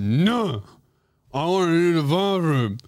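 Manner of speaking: mock whiny, slurred protest; childish refusal. Patient turning down the bedpan, mock childish whine. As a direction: refuse like a sulky little kid.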